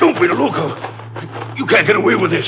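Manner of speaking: high-pitched urgent protest; strained, high plea to see reason; alarmed warning. Acted. Constable held at gunpoint pleads.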